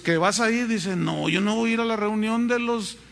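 ordinary talk

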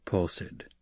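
ordinary delivery

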